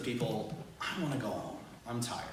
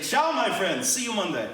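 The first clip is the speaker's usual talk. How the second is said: high, loud cheery greeting across the water; cheery call; 7 semitones higher, 6 dB louder than usual. Retold or imitated. Gondolier waving to students, calling out cheerily. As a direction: call out to them like a showman. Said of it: "I give them a huge wave and I say".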